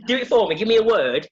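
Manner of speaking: usual speaking voice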